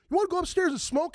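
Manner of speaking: put-on girl's voice. Quoting a girl.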